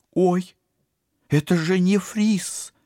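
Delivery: high, loud, stunned cry; shocked gasp. Acted. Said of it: "потрясённо ахнула".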